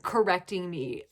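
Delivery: usual speaking voice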